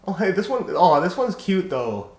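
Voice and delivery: high delighted squeal; thrilled and gushing, slow; excited. Real, not acted. Delighted by a collar.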